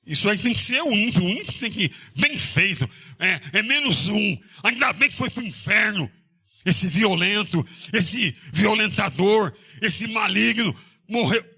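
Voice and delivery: high, scornful mock gloat; lightly voiced smug relief; a touch of hammed-up contempt. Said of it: "ele falava essas palavras".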